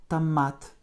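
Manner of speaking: "томат" said ta-MAT, first vowel a light A. Shown as a demonstration. Teacher showing O sounds like light A, "томат" as ta-MAT. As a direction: lighten the о of "томат" to A, stress the last syllable. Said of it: "presque prononcé comme un, un léger A".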